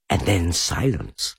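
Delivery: ordinary delivery, nothing marked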